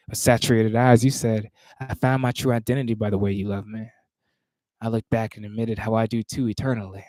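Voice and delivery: measured, rhythmic poetry recitation; spoken word performance cadence; spoken word poem. Acted. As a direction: perform the poem with weight on each phrase.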